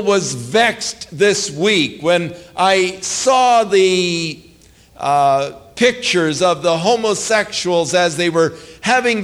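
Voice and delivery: impassioned preacher railing at the congregation; preaching at full force. Acted. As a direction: thunder it from the pulpit.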